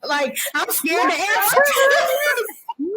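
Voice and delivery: helpless laughing protest; laughing mock fear. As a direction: pretend to be scared while cracking up. Friend reacting through laughter.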